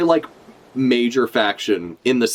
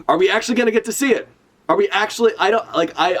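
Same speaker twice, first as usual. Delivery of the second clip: excited; 7 semitones higher, faster than usual. Real, not acted. Hoping to see the Reverie.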